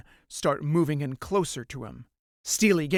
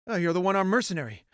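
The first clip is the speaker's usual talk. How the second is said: usual speaking voice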